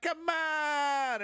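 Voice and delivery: high whooping shout; mock Jesse Waters shout. Pundit grinning at the camera, high mock shout. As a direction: yell it like a smug pundit. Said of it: "he was like".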